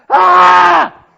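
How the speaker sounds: loud, high wail of heartbreak; sudden loud cry of grief; anguished cry. Acted. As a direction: let the broken heart cry out. Voicing parent's inner heartbreak.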